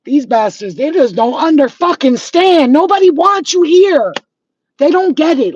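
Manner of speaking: loud, high, heated tirade; angry rant. Real, not acted. Woman yelling at viewers who hate her, furious. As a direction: blast them with real fury. Ranting at haters watching channel.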